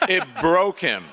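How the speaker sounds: high and loud, laughing through it; real delighted laughter. Real, not acted. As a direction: crack up while telling it. Laughing at the insult story.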